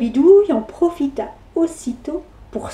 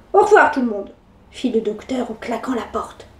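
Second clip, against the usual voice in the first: plain reading voice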